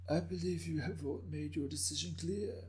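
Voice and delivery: put-on an old man chuckling softly through his words; raspy old man, amused. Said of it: "with a hint of laughter in his raspy voice".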